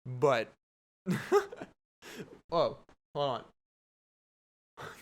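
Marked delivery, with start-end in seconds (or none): 1.0-1.6 sudden high-pitched laugh; a real laugh breaks out; surprised giggle, much higher; high burst of laughter. Real, not acted. Caught off guard mid-sentence.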